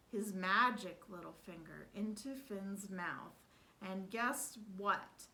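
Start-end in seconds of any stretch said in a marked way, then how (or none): none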